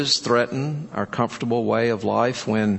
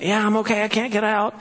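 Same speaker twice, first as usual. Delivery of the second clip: put-on trapped little boy; 8 semitones higher, faster than usual. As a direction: answer mom like a stuck three-year-old. Trapped small boy answers his mother.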